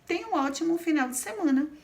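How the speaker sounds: high, quick, bright send-off wish; teacher demonstrating a wish with a high, quick, cheerful delivery. Shown as a demonstration. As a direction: wish them a great weekend with a lift. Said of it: "vai expressar um desejo".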